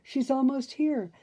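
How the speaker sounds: excited urging. A narrator's reading. Merryweather urging the fairies, high eager voice. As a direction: press them to hurry, high and deliberate. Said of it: "Merryweather cried".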